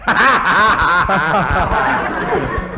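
long run of ha-ha laughter; hearty laugh. Acted. Man seeing his own photo on a poster, hearty laugh. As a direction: burst out laughing in recognition.

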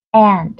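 AND with a tense, nasalized a, half a half e; căng, hơi mũi. Shown as a demonstration. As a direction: say AND with the vowel pulled tight toward e. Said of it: "đáp án số hai". (AND âm a căng, hơi mũi)

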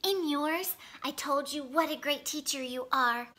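ordinary delivery, nothing marked

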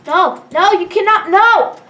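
insistent shout, climbing in pitch; loud protest. Real, not acted. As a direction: refuse loudly, building to a yell. Protesting in game danger.

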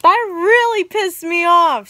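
loud, slow, furious yell; angry shout. Real, not acted. Vlogger ranting to viewers, loud and slow with fury. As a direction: spit it out slowly, livid. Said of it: "I'm mad".

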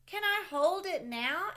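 put-on high, loud pleading child voice; eager little boy. Sam begging his dad to hold the kite, high eager child voice. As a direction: beg like a small kid who wants a turn. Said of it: "asked Sam".

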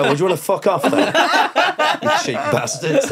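hammed-up telling off the family; half-laughing mock outburst at cheapskates; mock angry. Insulting the audience.

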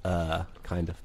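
low, soft, hesitant admission; embarrassed, shy. Retold or imitated. Girlfriend admitting trees talk back, embarrassed and shy. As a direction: imitate a shy, sheepish answer. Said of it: "she got real embarrassed and kinda shy".

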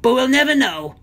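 high, playful villain aside; high-pitched mischievous villain voice; gleeful villain. A narrator's reading. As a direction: toss it off with wicked glee. Gargamel gleefully dismisses the doubt.

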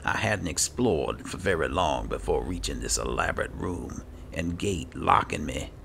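ordinary talk, nothing marked

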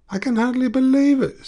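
a distraught man close to crying; upset, voice high with tears; tearful. A narrator's reading. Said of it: "said Coyotes tearfully".

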